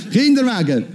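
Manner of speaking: laughing through Kinderwagen, soft. Real, not acted. Preacher laughing at own slip, laughing. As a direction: chuckle the word Kinderwagen.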